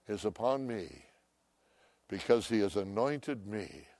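ordinary talk, nothing marked

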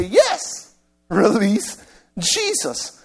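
high-pitched eager yes, then drawn out; slow, high imitation of a crowd calling out; imagined crowd's cry. Crowd's expected answer to Pilate.

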